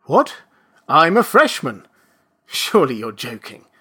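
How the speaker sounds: a hint of astonished disbelief; incredulous. A narrator's reading. Registrar hears the claim, incredulous.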